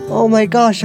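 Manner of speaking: ordinary delivery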